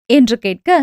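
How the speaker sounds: ordinary delivery, nothing marked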